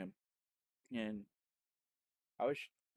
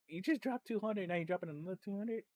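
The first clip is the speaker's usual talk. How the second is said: retold disbelief; 9 semitones higher, 3 dB louder than usual. Retold disbelief at second donation.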